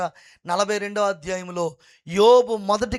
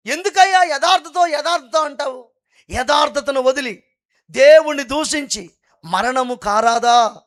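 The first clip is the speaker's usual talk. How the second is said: scolding wife; 4 semitones higher, 7 dB louder than usual. Retold or imitated. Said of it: "భార్యమణి వచ్చారు".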